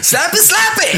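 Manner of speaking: gleeful mock cheering; laughing cheer. Real, not acted. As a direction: cheer him on through a laugh.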